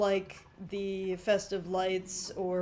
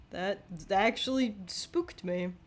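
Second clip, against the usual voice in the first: ordinary talk, nothing marked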